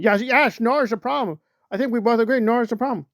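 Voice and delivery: hammed-up eager yes-man; mock eager Aaron. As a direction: nod along fast and eager. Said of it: "And Aaron's like".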